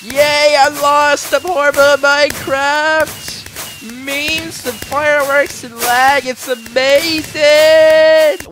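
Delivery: high, loud, drawn-out fake cheer; mock celebration yell. Player celebrating his own loss in game, mock celebration yell. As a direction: stretch every phrase into a mock-jubilant holler.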